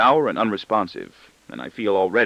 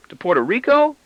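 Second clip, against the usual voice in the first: put-on boy's voice; 4 dB louder than usual. A narrator's reading. Boy voiced, asking.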